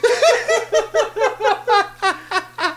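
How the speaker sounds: high helpless laugh; real high laughter. Real, not acted. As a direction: crack up again. Laughs again.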